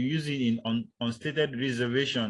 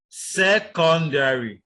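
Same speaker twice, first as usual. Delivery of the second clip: "secondary" punched out loud, syllable by syllable; stress on every syllable; 4 semitones higher, 7 dB louder, much slower than usual. Shown as a demonstration. Teacher imitating what many people say, every syllable stressed. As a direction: give each part of "secondary" equal heavy stress. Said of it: "put the stress on each of the syllables".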